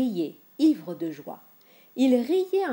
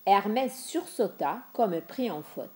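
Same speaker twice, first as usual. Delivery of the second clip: ordinary delivery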